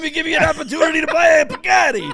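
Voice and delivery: put-on loud, high Long Island accent shout. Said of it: "give us your Long Island accent".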